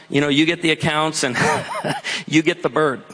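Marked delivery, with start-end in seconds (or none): 1.4-2.2 short real chuckle; laugh. Real, not acted. Pastor amused by inheriting the parrot, chuckling. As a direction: chuckle before the punchline.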